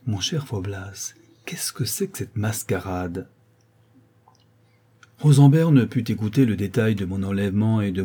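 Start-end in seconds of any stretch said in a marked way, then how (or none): 0.1-3.3 hushed, softer whispering question; confidential whisper. A narrator's reading. Rosenberg to Faublas once left alone, whispered. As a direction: whisper it privately to a friend.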